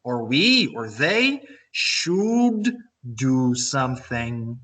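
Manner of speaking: word by word, stretched "you", "we", "they", "should"; very slow model. Shown as a demonstration. Teacher models the construction for students, very slowly drawn out. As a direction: spell out the pattern at half speed.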